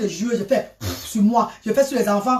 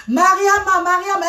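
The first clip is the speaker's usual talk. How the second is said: mother calling; 7 semitones higher than usual. Retold or imitated. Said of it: "elle m'appelle".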